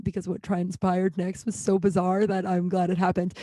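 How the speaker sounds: ordinary talk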